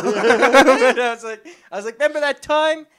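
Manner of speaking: high, laughing plea. Real, not acted. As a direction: squeeze words out through laughter. Laughing while retelling.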